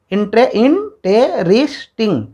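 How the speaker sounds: slow "इंटेरिस्टिंग", restarted, then four drawn-out beats; syllable by syllable. Shown as a demonstration. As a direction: say "इंटेरिस्टिंग" slowly, each syllable on its own. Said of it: "चार बार हमारा बोल रहे हैं". ("इंटेरिस्टिंग" का हर हिस्सा अलग, धीरे-धीरे बोलें)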